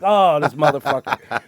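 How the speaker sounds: loud, slowed, laughing; exasperated laugh. Retold or imitated. Driver reacting to the signaller, loud laughing. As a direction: say it laughing in disbelief.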